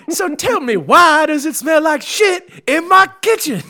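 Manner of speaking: very high, loud, slow, breaking into laughter; head chef raging at his cooks over the smell; furious chef shout. Pretend. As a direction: demand it at full volume. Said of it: "I really hinged it all on the line".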